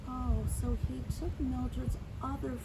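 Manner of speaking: ordinary delivery, nothing marked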